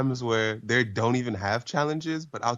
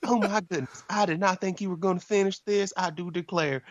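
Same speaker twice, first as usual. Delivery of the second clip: high, astonished Southern belle; mock Southern waitress, pitched way up; 10 semitones higher than usual. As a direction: gush like a hammed-up Southern lady. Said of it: "She has a really southern accent".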